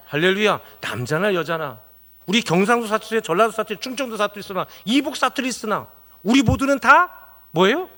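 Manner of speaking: loud, high impassioned sermon; a pastor's fiery call from the pulpit; fervent preaching. Acted. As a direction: preach it out with full force, rising high. Preaching at full force.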